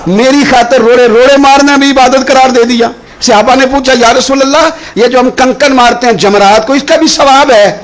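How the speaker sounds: high-pitched, insistent preacher delivery; impassioned preaching. Acted. Preacher retells Ibrahim's trial to the congregation, impassioned.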